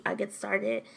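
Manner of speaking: normal talking voice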